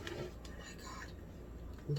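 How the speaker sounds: slow awed whisper. Real, not acted. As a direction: hush it out slowly. Overwhelmed by the plate.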